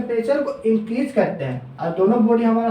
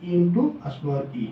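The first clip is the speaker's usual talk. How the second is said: formula spelled out slowly, word by word; slow dictation; 5 semitones lower, 5 dB quieter, much slower than usual. Shown as a demonstration. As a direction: dictate it slowly so students can write. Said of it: "stress equal to हमें क्या लिखते हैं?". (धीरे-धीरे लिखवाते हुए)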